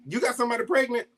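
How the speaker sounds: surprised. Retold or imitated. Mom's shocked guess, retold.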